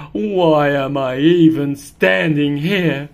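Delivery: a hint of a bored house cat; lightly voiced grumbling cat. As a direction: mope aloud like a bored pet. Cat character voice.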